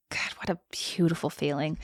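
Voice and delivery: breathy hushed whisper, moved by the passage; whispered aside. Real, not acted. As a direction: whisper it to yourself in awe. Whispered aside while reading book.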